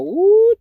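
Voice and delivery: high loud ooh of delight; impressed ooh. Retold or imitated. Roommate watching the dance, high delighted ooh.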